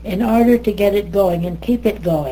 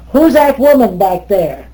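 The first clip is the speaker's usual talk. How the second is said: raised, higher-pitched mock challenge; imitating stern teacher; 4 semitones higher, 8 dB louder than usual. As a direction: call out like an affronted teacher. Teacher challenges latecomer in class.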